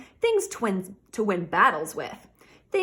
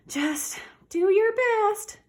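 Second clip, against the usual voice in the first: high, slow and louder, coaxing; encouraging princess; 11 semitones higher, 4 dB louder, much slower than usual. A narrator's reading. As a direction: cheer on the little pony gently. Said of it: "she told the pony".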